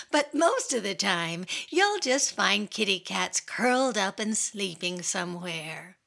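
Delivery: usual speaking voice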